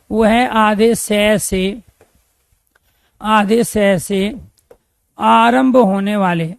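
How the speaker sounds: very slow, word by word, for note-taking; dictating at half speed; slow dictation. Shown as a demonstration. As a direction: spell it out slowly so students can write. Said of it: "teacher dictates slowly".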